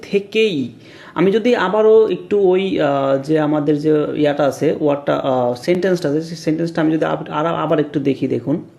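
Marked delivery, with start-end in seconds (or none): none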